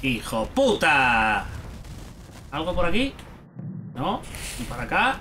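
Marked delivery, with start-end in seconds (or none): 0.0-1.4 slow, low-pitched swearing; low grumbled cursing. Real, not acted. Player curses the pestering game, low grumble. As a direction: draw the insult out low.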